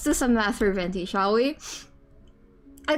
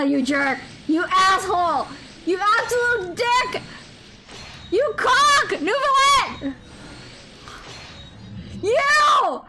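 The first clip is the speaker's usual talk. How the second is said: high, loud playful insults yelled at the screen; mock fury; 13 semitones higher, 4 dB louder than usual. Real, not acted. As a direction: rage at him, half laughing.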